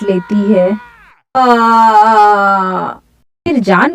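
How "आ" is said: long falling "aah" of pain; acted drawn-out yelp, tailing downward; pained cry. Sheru gets his hand bitten, drawn-out pained cry.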